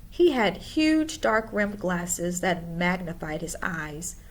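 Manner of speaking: ordinary delivery, nothing marked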